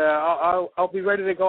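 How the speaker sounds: ordinary talk, nothing marked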